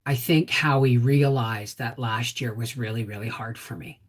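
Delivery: whispered and choked up; fighting tears, barely voiced; holding back tears. Real, not acted. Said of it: "I'm trying not to get emotional".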